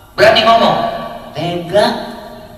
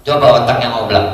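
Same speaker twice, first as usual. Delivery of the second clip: ordinary talk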